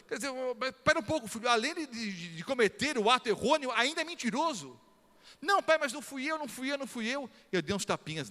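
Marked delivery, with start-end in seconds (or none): 0.1-4.8 raised, fast stern scolding; a father's rising reprimand. Retold or imitated. Father scolding lying son. 5.4-7.3 put-on high, whiny child's denial; a small boy insisting it wasn't him; boy pleading his innocence to his father.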